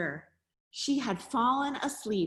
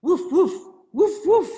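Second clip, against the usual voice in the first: loud, higher, short woofs; dog barking; 5 semitones higher, 9 dB louder than usual. A narrator's reading. Reader voicing the dog for a child, loud barks. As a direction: bark it like a dog. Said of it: "barked the dog".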